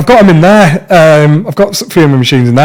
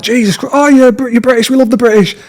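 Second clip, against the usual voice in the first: much higher, gushing American delight; excited fans; 10 semitones higher than usual. Retold or imitated. Tattooist imitating Americans thrilled by his British flag, excited. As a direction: burst out thrilled at meeting a Brit.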